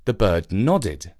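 usual speaking voice

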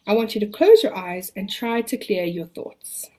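calm the listener, long pauses. Acted. Leads a relaxation exercise.

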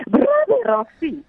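lightly imitated old friend's call; imitated greeting. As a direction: mimic how a friend hails him. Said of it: "you are the only one ever calling me".

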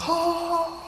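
high, soft sigh of relief; airy relieved exhale. Retold or imitated. Sudden landing relief.

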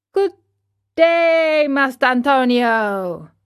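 high, loud opening, falling away; hailing greeting. Acted. Geppetto greeting the carpenter, hailing him. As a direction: hail him in a sing-out call.

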